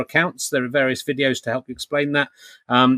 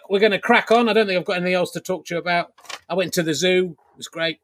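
ordinary delivery

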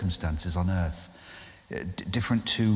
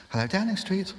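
higher, polite receptionist voice; switchboard operator; 10 semitones higher than usual. Retold or imitated. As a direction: answer crisply as the Downing Street switchboard. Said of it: "the phone begins to ring".

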